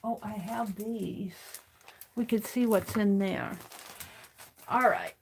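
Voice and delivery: normal talking voice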